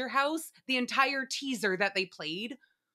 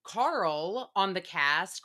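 usual speaking voice

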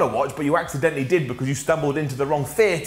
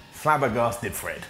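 flabbergasted; 6 semitones lower, 4 dB quieter, slower than usual. Retold or imitated. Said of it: "I was a bit like".

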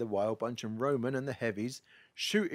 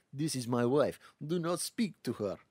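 put-on Russian accent; 3 semitones higher than usual. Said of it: "Russian guy is like".

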